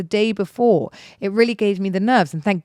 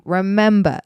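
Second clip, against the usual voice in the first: normal talking voice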